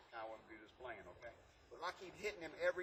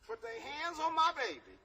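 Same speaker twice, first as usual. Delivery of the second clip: higher, louder fierce mother; protective mama; 12 semitones higher, 5 dB louder than usual. Retold or imitated. Teacher imitating a mother protecting her baby, fierce and high. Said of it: "If somebody".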